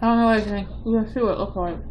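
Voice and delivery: chewing between words; talking while eating. Real, not acted. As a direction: mouth partly full of cookie. Seen 0: Cookie held at mouth while speaking; face upside-down, partly hidden.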